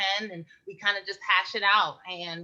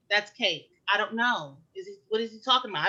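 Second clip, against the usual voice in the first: usual speaking voice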